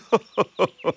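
high, put-on staccato chuckle; fox's laugh. Fox laughing off the hens' fear, amused chuckle.

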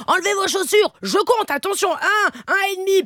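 high strict mother counting down; mock strict mother. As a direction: scold the kids from high up. Mother scolding children. (mère qui compte aux enfants, voix haute)

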